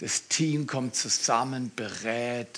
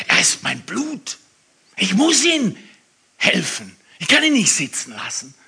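loud, higher, pleading; shocked outcry; 6 semitones higher, 9 dB louder than usual. Retold or imitated. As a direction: cry it out as if stunned. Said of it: "das so schockiert sagt". (schockiert und laut ausgerufen)

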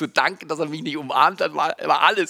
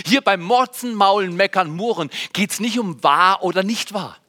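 ordinary delivery

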